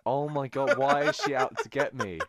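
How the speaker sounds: high, loud, laughing protest; mock outrage. Co-host protesting the sister's criticism, in laughing mock outrage. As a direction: play wounded, drawing the words out loudly.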